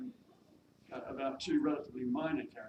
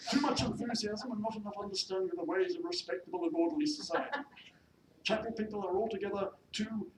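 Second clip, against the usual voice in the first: haughty, high-pitched pronouncements; disapproving mistress; 8 semitones higher, 7 dB louder than usual. Acted. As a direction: lay down the law as a snobbish lady. Mistress disparages chapel people.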